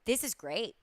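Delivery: dry sarcastic quiet remark; deadpan it, softly. Real, not acted. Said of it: "I say with sarcasm".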